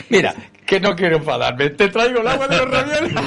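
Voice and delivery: high, giggly imitation of the neighbour; laughing mock neighbour. Neighbour at the wake offering water, high laughing voice. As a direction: squeaks it out between laughs, teasing.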